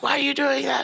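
high, exasperated mom voice; annoyed mom. Retold or imitated. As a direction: squeal it like an irritated mother. Mom woken, gets mad.

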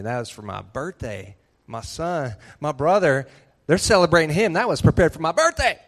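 put-on jealous older brother. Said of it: "He's probably thinking".

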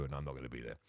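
dropped very low and quiet; coy aside. Real, not acted. Host teasing listeners about the next show, coy aside. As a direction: tail off into a low, hushed denial. Teasing denial after wink, wink.

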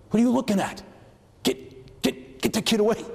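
high, urgent call to someone who can't hear; frantic yelling. Retold or imitated. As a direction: hiss it out, then cry out high. Yelling at camera from far away.

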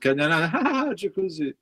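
a hint of the crowd insisting on the joke song; mocking the crowd. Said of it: "Mais le monde". (légère imitation moqueuse du public, voix plus aiguë)

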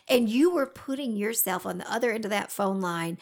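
ordinary talk

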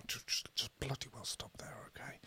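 hushed whisper telling himself to stop. Real, not acted. Host whispering to himself to stop the banter. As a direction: quietly whisper it, cutting yourself off.

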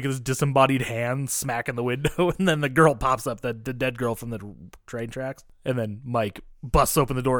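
ordinary delivery

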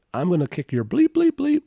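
hammed-up angry suspect; belligerent threat. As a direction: imitate him snapping threats, rising high at the end. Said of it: "He's still belligerent".

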